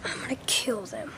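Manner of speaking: whispered threat, low and soft; scheming whisper. Acted. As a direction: hiss it like a secret plan. Plotting revenge on the pigs.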